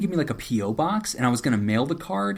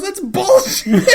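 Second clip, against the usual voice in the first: high, drawn-out indignant yell; loud mock outburst breaking into laughter; 9 dB louder, slower than usual. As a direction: imitate an outraged yell, laughing.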